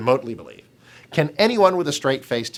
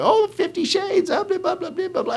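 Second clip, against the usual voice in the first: mock gushing morning-TV host, much higher. Host mocking a morning TV presenter, much higher and gushing. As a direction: ham up a giddy talk-show host brushing it off. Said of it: "Lisa's at home saying".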